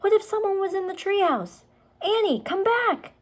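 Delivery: put-on a boy yelling into the dark; calling out. A narrator's reading. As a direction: shout up after her anxiously. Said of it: "Jack called".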